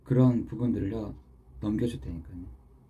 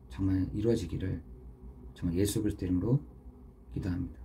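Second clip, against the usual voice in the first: ordinary talk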